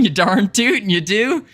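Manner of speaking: hammed-up cowboy twang; southern accent. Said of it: "trying to do this southern accent".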